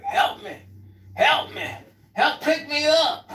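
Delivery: put-on pleading old man. Old man fallen, begging for help.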